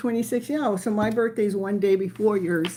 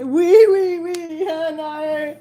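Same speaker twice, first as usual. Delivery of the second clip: playful squeal; 5 semitones higher, 7 dB louder, slower than usual. Real, not acted. Playful excited cry.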